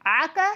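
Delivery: "आका" with the first vowel held in the throat; throaty ain. Shown as a demonstration. Teacher repeats the hard part, "आका" slow from the throat. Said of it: "इसकी आवाज़ गले से निकालते हैं".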